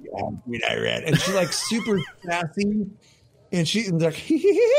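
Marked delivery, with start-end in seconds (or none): 0.0-1.0 much lower, smoky sassy orc queen; Queen Iran voice. Retold or imitated. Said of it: "besides the smoker's voice". 4.2-4.7 high, loud mock giggle; imitated giggle. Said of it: "her laugh".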